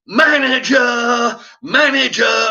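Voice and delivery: high, drawn-out repeated yell; screaming for the manager. Retold or imitated. Fed-up customer demanding the manager, screaming. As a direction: holler it loud, stretching the word. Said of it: "And I'm screaming at this point".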